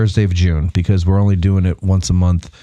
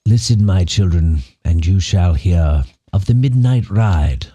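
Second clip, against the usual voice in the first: slow, lower British recitation; grand British verse reading, falling low at line ends; British accent; 4 semitones lower than usual. Acted. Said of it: "Why is he British?".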